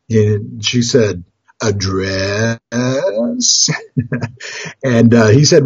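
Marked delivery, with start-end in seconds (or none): 1.6-3.8 long, drawn-out coy answer; mock-guilty, slowly rising reply; sheepish confession. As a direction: admit it reluctantly, stretching the last word. Wife sheepishly confesses what she bought.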